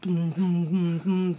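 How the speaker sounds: ordinary talk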